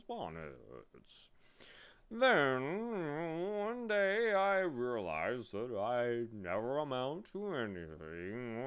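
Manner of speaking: slow, swooping, drawn-out bee voice; reminiscing bee. A narrator's reading. Bee telling Milo its history, slow self-important drawl. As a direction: tell a life story in long sliding words.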